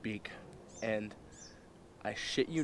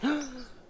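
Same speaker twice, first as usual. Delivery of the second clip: high gasp, imitating her; sudden scandalized intake; shocked gasp; 12 semitones higher than usual. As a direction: gasp in mock alarm.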